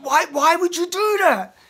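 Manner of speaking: high, loud, incredulous outburst; outraged plea. Pretend. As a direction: demand an answer in fury. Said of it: "That's the energy that's inside".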